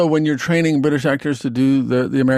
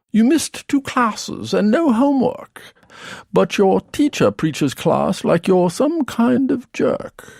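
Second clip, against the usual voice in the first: put-on higher, slow British accent reading lyrics; 7 semitones higher, slower than usual. Beastie Boys lyrics in British accent.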